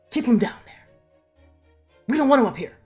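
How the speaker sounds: acted urgent, angry command; fierce order. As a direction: bark it out emphatically. Acting out Janet's fierce order.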